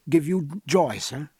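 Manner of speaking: ordinary delivery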